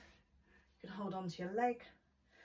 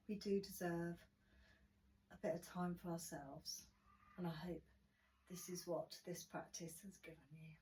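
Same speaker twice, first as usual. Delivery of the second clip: guided relaxation; 7 dB quieter than usual. Acted. Guided relaxation closing the class.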